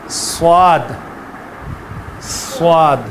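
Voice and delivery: سواد with a heavy, full s sound; sad sounds. Shown as a demonstration. Teacher demonstrating the letter sound, heavy s. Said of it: "دیکھیں، ہاں،".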